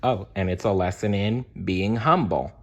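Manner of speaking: usual speaking voice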